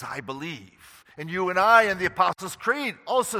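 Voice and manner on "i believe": softer, hushed "I believe"; quiet confession. Retold or imitated. Thomas quietly confessing his faith, softly. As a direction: say it gently, almost to yourself. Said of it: "He says".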